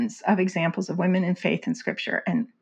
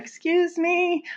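sassy excuse me; 10 semitones higher, slower than usual. Retold or imitated. Retold pushback to Doctor Mohler.

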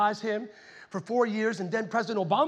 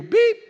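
phone beep; 11 semitones higher, 9 dB louder than usual. Retold or imitated. Phone hangs up.

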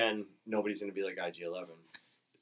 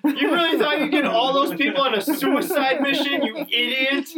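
much higher, loud laughing mock rant at Poe; mock scolding; 13 dB louder, slower than usual. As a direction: scold Poe like a fool, cracking up. Mocking Poe's failed rally.

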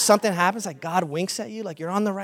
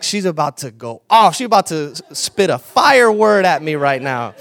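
amused thought; 2 semitones lower, 10 dB louder than usual. Retold or imitated. Pastor's inner thought, amused.

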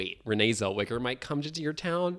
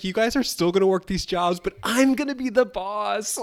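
high, louder gloating; mock boss; 9 semitones higher, 6 dB louder than usual. She tells the workers she will be boss, gloating. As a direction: crow it like a smug new boss. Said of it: "she was like".

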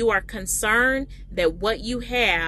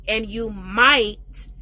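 "might" slow and louder, held at the end; stress on might; 3 dB louder, much slower than usual. Shown as a demonstration. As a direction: linger on the word might. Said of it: "emphasizing the word might there".

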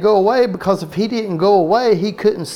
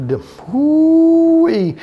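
high, slow drawn-out whoo-wee; tongue-tied whoo-wee; 12 semitones higher, 4 dB louder, much slower than usual. Real, not acted. Preacher laughing at his own flubbed words with a high whoo-wee. Stumbles over deer park.

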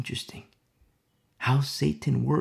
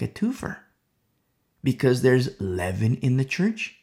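normal talking voice

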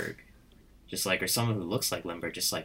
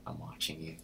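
softer, hushed "I'm watching you"; a faint, creepy memory of the threat; a half-whispered, distant warning; soft menacing recall; 4 semitones lower, 11 dB quieter than usual. Retold or imitated. Danny DeVito recalled in her mind.

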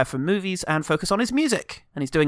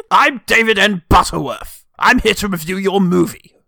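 puffed-up self-important critic, louder; pompous reviewer; 3 semitones higher, 6 dB louder than usual. Pretend. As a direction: announce yourself like a pompous critic.

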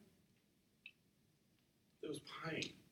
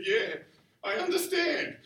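loud, high, laughing admission; laughing agreement; 12 semitones higher, 6 dB louder, slower than usual. Retold or imitated. Man answering his dying friend's reminder, laughing loudly. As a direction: laugh it out knowingly, pitch jumping up. Retold laughing reply at hospital bedside.

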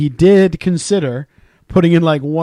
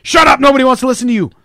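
high, loud mock scream at a kid; a parent yelling at a screaming child, mock scream; imitating a screaming parent; 9 semitones higher, 5 dB louder than usual. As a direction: yell it down like an angry parent. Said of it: "the parent then in turn screaming at the".

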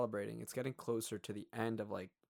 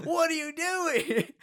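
much higher, louder, slow squeal; mock exasperated shriek; 10 dB louder, slower than usual. Joining the friend's joke about refusing gifts, high mock shriek. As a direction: squeal it in fake outrage. Speaker joins the mock outburst.

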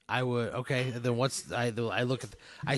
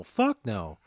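usual speaking voice

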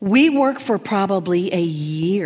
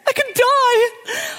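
much higher, crying wail of panic; higher panicked sobbing voice; panicked friend; 4 dB louder, slower than usual. Retold or imitated. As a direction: squeal it out like the world is ending. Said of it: "she's like, in a panic".

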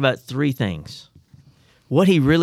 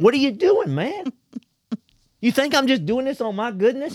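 talking donkey; 11 semitones higher than usual. Acted. Talking donkey scolds Balaam.

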